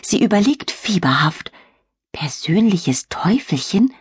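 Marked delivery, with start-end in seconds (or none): 2.1-3.9 slow, hushed whisper. Acted. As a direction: whisper it to yourself. Girl thinks to herself.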